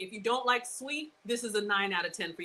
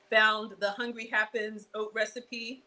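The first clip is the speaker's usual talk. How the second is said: usual speaking voice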